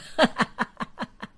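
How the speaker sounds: quiet chuckle; a short soft laugh at his own joke. Acted. Husband laughing at his joke about his wife, quiet chuckle.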